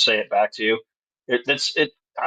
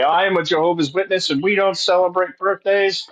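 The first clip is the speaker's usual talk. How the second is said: higher-pitched, self-righteous declaration; mock solemn proclamation of belief; making a stand; 6 semitones higher than usual. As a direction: declare it like a proud zealot. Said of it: "you would make a stand".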